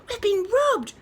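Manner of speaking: high, loud rooster cry. A narrator's reading. Rooster finds the eggs gone, loud cry. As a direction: cry out in alarm. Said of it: "cried the rooster".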